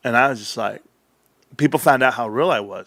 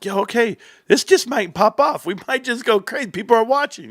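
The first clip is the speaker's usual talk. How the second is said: excited; 9 semitones higher than usual. Retold or imitated. Retelling his hype at viewers.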